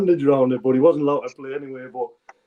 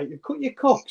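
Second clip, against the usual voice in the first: mock disbelief; 11 semitones higher than usual. Sudden realisation, high.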